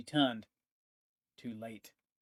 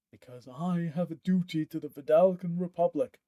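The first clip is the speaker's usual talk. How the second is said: higher, louder declaration of duty; dutiful soldier; 5 semitones higher, 5 dB louder than usual. Acted. Marek telling Pontifex his duty to the Republic, proud. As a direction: proclaim your loyalty.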